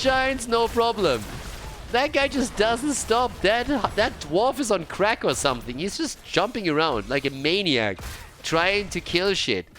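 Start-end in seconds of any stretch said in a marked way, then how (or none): none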